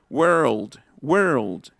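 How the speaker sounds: world as "werld", with no O sound; English ER vowel. Shown as a demonstration. Teacher adding the word for mundo, pronunciation demo. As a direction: say world singly with a closed r-coloured vowel. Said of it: "también la palabra mundo".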